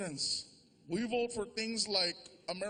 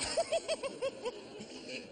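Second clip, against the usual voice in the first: high giggle; giddy laugh; 13 semitones higher, 4 dB quieter than usual. Real, not acted. Comedian laughing at his soda craving, giddy.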